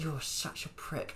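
slow and quiet through clenched teeth; through gritted teeth. Retold or imitated. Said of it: "when you're saying it through gritted teeth".

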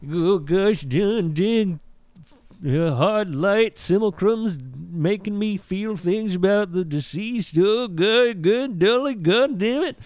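high, cracked prospector rambling; crazy old prospector. Acted. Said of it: "crazy old prospector".

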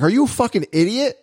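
high-pitched mock wife, incredulous; imitating exasperated wife. Husband retells his wife's scolding, high mock-exasperated voice. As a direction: jump up high to mimic her disbelief. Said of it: "She's like".

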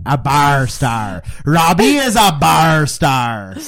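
loud, drawn-out hype; loud proclamation. Real, not acted. Hyping up a friend as a local legend, loud proclamation. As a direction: proclaim it slowly, stretching each word.

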